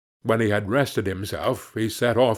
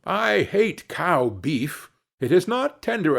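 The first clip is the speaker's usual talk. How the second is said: high-pitched, indignant lament; dismayed complaint; 6 semitones higher than usual. A narrator's reading. Hans complains to the butcher about the swindle, dismayed. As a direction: bemoan a bad bargain in a raised voice.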